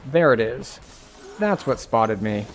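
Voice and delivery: ordinary delivery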